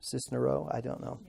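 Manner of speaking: normal talking voice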